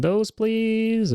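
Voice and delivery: sudden high squeaky please; playful high please. Real, not acted. Playful polite request, pitch jump.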